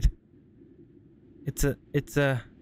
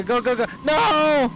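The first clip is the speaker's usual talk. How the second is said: panicked scream; 9 semitones higher, 6 dB louder than usual. Real, not acted. Monster catches player.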